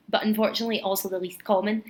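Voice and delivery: normal talking voice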